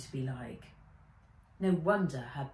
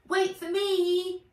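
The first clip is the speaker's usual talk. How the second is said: higher, louder, slow shout; calling out; 13 semitones higher, 7 dB louder, slower than usual. A narrator's reading. Fizz calls after Nat from the boat, shout. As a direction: call after her from above. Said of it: "She looked up and sighed".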